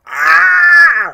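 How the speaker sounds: high, loud cartoon yelp; cartoon scream. Fan imitating Gerald getting zapped, cartoon scream. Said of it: "just go".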